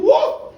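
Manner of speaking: very high, loud whoop; sudden high burst; take-off whoop. Acted. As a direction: whoop as if yanked skyward. Storyteller imitates sudden take-off.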